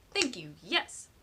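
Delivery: loud, gratified exclamation; emphatic approval. A narrator's reading. Penny slaps the table at the idea, emphatic. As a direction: seize on the idea with a burst.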